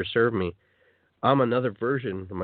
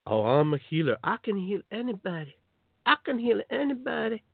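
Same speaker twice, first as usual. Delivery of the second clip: mock boastful healer; 8 semitones higher than usual.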